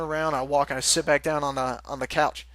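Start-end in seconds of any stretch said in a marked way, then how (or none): none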